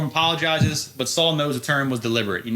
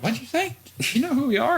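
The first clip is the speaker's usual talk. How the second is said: high mock outrage; 12 semitones higher than usual. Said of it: "No, fuck you up".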